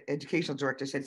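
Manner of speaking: ordinary delivery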